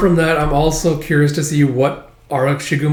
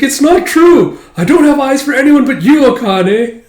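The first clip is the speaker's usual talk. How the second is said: high, loud melodramatic declaration; hammed-up pleading; 11 semitones higher, 8 dB louder than usual. Suitor swearing devotion to Akane, hammed-up pleading. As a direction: plead far too earnestly, breaking into a laugh at the end. Desperate confession in retold quarrel.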